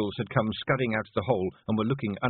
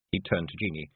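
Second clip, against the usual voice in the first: usual speaking voice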